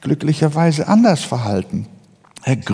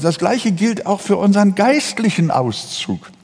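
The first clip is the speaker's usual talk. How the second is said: normal talking voice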